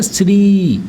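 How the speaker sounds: "श्री" said as "shiri", with a vowel slipped in between "श" and "री"; wrong pronunciation. Shown as a demonstration. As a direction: split "श्री" into two syllables. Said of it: "लेकिन बोलते कैसे?".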